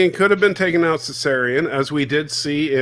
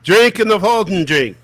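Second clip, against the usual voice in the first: loud mock garbled Irish talk; higher, louder imitation of gibberish; mimicking the friend; 5 semitones higher, 6 dB louder than usual. Co-host mocks a friend's unintelligible speech, loud imitation. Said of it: "he's like".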